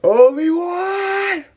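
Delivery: long, high, loud scream; drawn-out theatrical cry; Vader yelling. Acted. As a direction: bellow the name slowly in hammed-up rage. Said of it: "Vader's like".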